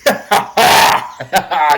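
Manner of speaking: loud booming laugh; hearty laugh. A narrator's reading. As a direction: laugh out with open delight. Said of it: "He laughed".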